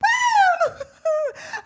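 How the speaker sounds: high laughing shriek. Real, not acted. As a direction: explode into a screaming laugh. Loud laugh at the song.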